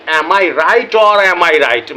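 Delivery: "right" in "or am I right" stretched very slowly and leaned on; slow deliberate stress. Shown as a demonstration. Explaining his catchphrase to viewers, spelling it out slowly with stress on the last right. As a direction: drag the whole line out very slowly and land hard on the final "right". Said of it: "দ্বিতীয় শব্দের উপরে জোর দেওয়া হচ্ছে".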